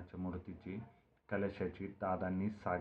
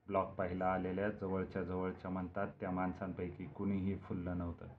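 ordinary delivery

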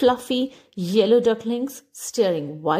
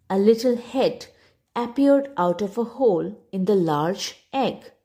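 ordinary delivery, nothing marked

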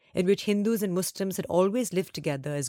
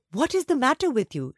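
exasperated mother; 7 semitones higher, 3 dB louder than usual. Acted.

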